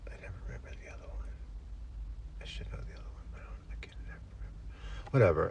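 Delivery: hushed whisper under the breath; whispered aside to yourself while failing to recall; whispered muttering. Acted. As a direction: mumble it privately, barely voiced. Muttering forgotten name to himself.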